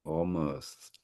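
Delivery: hushed, whispered echo. Real, not acted. Host echoing the poem after hearing it, whispered. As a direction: whisper the word as if savouring it. Host savours the poem's key word.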